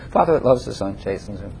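dropped to a soft, low murmur; hushed third repeat of the verse. Shown as a demonstration. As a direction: let it fall away quietly. Repeated, much softer third time.

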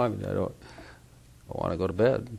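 worn-out husband begging his wife to let him sleep; sleepy plea. Retold or imitated. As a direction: fade out wanting to sleep. Tired husband wants to sleep.

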